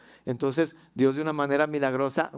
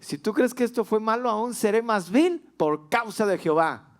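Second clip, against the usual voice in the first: higher, proud defiant reply, drawn out at the end; defiant David; 7 semitones higher than usual. Pretend. David answering his wife Michal, defiant and joyful. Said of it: "David voltea y le dice".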